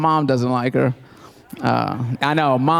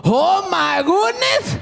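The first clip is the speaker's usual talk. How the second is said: high excited shouts, stretched oh my goodness; ecstatic dad shout; 13 semitones higher, much slower than usual. Retold or imitated. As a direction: cheer like the team just won. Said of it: "he's like".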